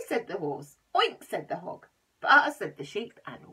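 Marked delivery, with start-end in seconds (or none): 0.9-1.2 high loud oink. Acted. The hog oinking in the finale, high loud oink. 2.2-2.5 loud bleat; baa. Acted. The sheep bleating in the finale, loud baa.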